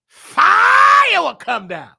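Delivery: stretched out very slow, high and loud; fervent. Real, not acted. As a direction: lift it up like the spirit falling. Recalling the spirit in choir.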